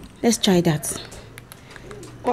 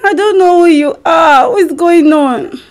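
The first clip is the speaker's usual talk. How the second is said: amnesiac confusion. Pretend. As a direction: play dumb, totally confused. Wife fakes amnesia prank call.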